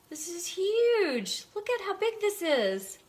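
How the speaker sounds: high, slow excited cry; excited exclamation. Real, not acted. Showing the huge balm.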